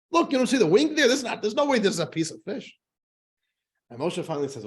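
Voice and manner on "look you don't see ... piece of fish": exasperated priest. Acted. Priest arguing fish or chicken.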